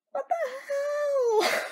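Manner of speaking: very high, slow, crying outburst; shocked wailing cry. Real, not acted. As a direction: wail it out in disbelief. Reacting to very sour taste.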